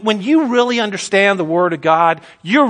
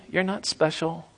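softer, hushed repeat; dropped low; 3 semitones lower, 10 dB quieter than usual. Acted. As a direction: pull the voice right down and let it land quietly. Repeated line, dropping away.

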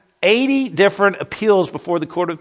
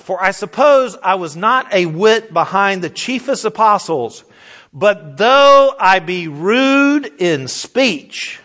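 scripture reading; 2 semitones higher, 4 dB louder, slower than usual. Acted.

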